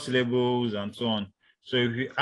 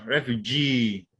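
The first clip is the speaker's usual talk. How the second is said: refugee slowly, GEE drawn out; stress on third syllable; slower than usual. Shown as a demonstration. Teacher giving a third-syllable example, refugee stressed on GEE. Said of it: "on the third syllable".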